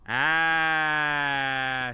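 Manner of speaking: long, loud, level "aaah"; drawn-out "ah". Real, not acted. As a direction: hold a loud "ah" on one steady note. Sudden realization of a mistake.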